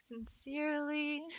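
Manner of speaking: playfully over-formal; mock-formal sign-off. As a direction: stretch it out like a lofty letter closing. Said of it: "It's so formal".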